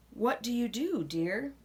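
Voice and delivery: ordinary delivery, nothing marked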